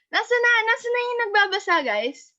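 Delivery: high and loud, rushing the words; calling out. Real, not acted. Teacher calling out to the class for the next reader, loud and high. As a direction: call out to the class for the missing reader.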